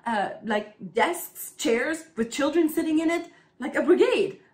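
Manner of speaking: patiently stating the obvious through giggles; explaining child. Retold or imitated. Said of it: "I'm like".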